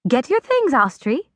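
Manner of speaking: a hint of a curt aunt sending her off; aunt's sharp order. A narrator's reading. Aunt orders the girl away.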